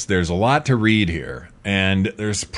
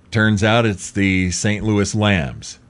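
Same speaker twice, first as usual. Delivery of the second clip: usual speaking voice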